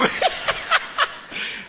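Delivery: high breathless burst of laughter; real laughter. Real, not acted. Preacher laughs.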